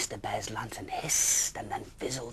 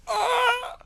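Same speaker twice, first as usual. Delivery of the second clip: very high loud yelp; startled yell; 13 dB louder than usual. Acted. Bear slipping in the tree, yelling. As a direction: yell as you slip. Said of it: "yelled Mister Bear".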